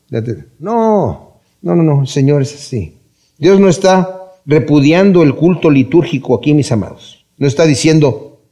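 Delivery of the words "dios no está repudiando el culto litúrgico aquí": usual speaking voice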